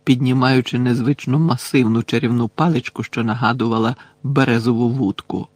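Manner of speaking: plain reading voice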